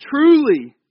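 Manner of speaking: high, loud proclamation; emphatic scripture reading. A narrator's reading. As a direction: proclaim the opening word of the verse. Preacher reads verse emphatically aloud.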